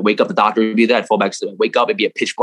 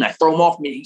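normal talking voice

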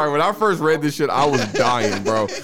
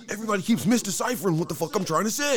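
fast, high-pitched mock frustration; exasperated imitation; 8 semitones higher, 3 dB quieter, faster than usual. Imitating a misunderstood rapper explaining his videos, exasperated. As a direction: put on a worked-up, misunderstood complainer.

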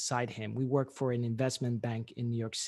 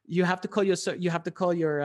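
loud, higher, emphatic explaining; exasperated protest; 5 semitones higher, 7 dB louder than usual. Retold or imitated. Consultant repeats himself to panicking florist, exasperated. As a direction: insist loudly on the impossible. Retold answer repeated over laughter.

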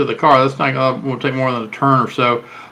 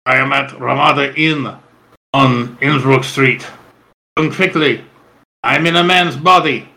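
put-on Russian accent. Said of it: "the guy has a Russian accent".

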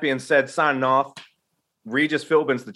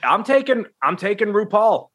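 excited; 9 semitones higher than usual. Real, not acted. Excited pick after joke.

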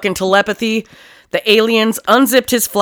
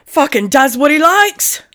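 higher, louder mock Cockney; hammed-up British accent; 6 semitones higher, 4 dB louder, slower than usual. Host mocking the killer's story in a hammed-up British accent. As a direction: ham up a rough British bloke.

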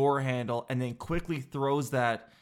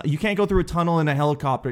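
ordinary talk, nothing marked

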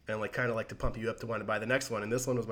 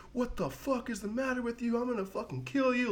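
retold horrified fury, pitched way up; appalled outburst; 11 semitones higher than usual. Nemo returns from seeing the body, appalled and furious. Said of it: "just appalled by what he saw".

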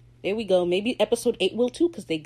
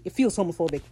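ordinary talk, nothing marked

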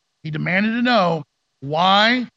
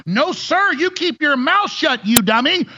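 higher, slow scolding of a dummy; mock scolding; 8 semitones higher, slower than usual. Mocking the censors scolding people who speak up. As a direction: talk down like a smug censor.

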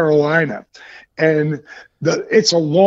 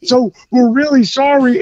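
higher-pitched, imitated meek apology; Big Show; 6 semitones higher than usual. Passenger apologizing to angry officer, mock meekness. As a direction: plead sheepishly with the officer.

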